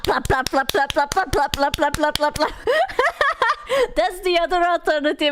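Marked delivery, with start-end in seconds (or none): 0.0-2.5 rapid string of repeated plops; imitated plopping. Streamer imitating what would be heard instead of snoring, imitated plopping. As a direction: mimic a rhythmic plopping noise.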